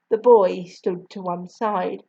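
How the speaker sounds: ordinary delivery, nothing marked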